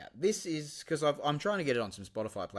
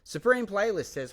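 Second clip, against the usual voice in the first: ordinary talk, nothing marked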